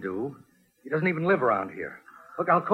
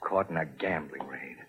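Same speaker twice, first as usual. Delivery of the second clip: low, much softer, disgusted aside; bitter mutter; 11 semitones lower, 9 dB quieter than usual. Acted. Larry pacing in police court, muttering bitterly. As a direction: mutter it under your breath in disbelief.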